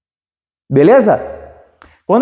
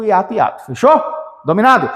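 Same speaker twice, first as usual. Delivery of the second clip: ordinary talk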